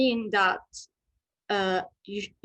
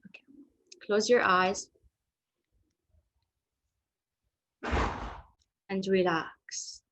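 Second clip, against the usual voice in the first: hypnotherapy voice. Acted. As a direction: lull them into calm, drifting into whispers. Leads a hypnotherapy relaxation.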